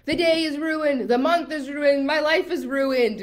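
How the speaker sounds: high, loud, slow catastrophizing; hammed-up wail of everything lost, drawn out; spiraling despair. Coach voices a manifestor's spiral, overdramatic despair. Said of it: "we catch ourselves spiraling".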